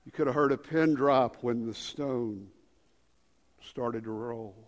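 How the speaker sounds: low, soft storytelling; hushed suspense. Real, not acted. Preacher tells of the stone starting to roll, hushed. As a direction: hold them in a pin-drop hush.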